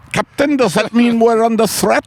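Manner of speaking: high, urgent crewman's call; crewman's plea. Pretend. Submarine crewman calling up to the captain, high urgent. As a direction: plead with the captain.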